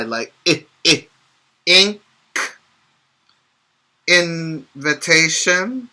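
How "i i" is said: "I" said as the short sound in ink; short i demo for kids. Shown as a demonstration. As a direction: clip "I" to the short i of "in". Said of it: "these are the short I".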